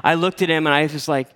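normal talking voice

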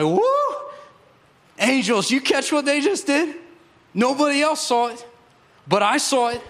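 high-pitched, excited cheer to the angels; imagined excited God. Acted. God showing off to angels what people just did, excited. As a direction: crow with delight, high and thrilled.

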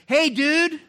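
put-on high, loud, bright "Hey, dude!" like a kid bursting in; excited greeting. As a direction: shout it happily as a kid coming home. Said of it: "he's like".

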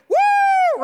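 very high, loud "woo"; long crowd-style whoop for a touchdown; celebratory whoop. Acted. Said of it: "your team scores a touchdown".

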